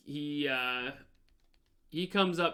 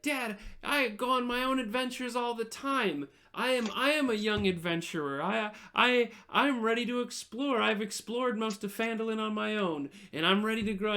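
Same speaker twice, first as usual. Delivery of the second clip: put-on higher-pitched, earnest child adventurer; eager little boy; 7 semitones higher than usual. Little boy telling dad he's an adventurer, eager boasting. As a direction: plead proudly like a boy wanting adventure.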